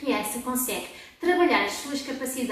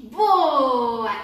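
usual speaking voice

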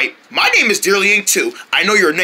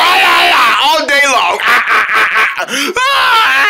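loud hammed-up bawling; mock crying; 6 semitones higher, 4 dB louder, much slower than usual. Mean guy taunting Joe about crying, mock bawling. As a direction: bawl in high, broken "ah"s, overdone. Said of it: "you're going home to cry".

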